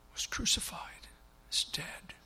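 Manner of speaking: Mary telling the angels of her loss, whispered; grief-stricken whisper. Acted. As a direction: let the words fall away to a whisper. Mary grieving at the tomb.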